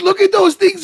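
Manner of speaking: high, loud mock hysterics; hammed-up teasing cry; imitating crying with laughter. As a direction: squeal it as if helpless with laughter. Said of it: "And he's literally crying".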